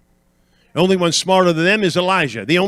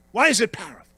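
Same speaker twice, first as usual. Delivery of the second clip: ordinary delivery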